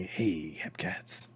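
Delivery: soft, whispered hepcat greeting; cool hepcat. Acted. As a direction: breathe it out like a jazz cat. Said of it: "You hear Victoria say".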